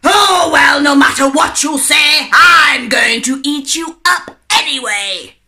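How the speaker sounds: loud, high shouting wolf. A narrator's reading. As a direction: roar it at the girl. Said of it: "cried the wolf".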